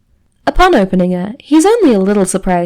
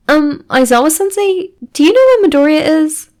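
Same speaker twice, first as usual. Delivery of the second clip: tentative question; 8 semitones higher than usual. A narrator's reading. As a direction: ask it hesitantly, raised higher. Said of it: "tentatively raises her hand".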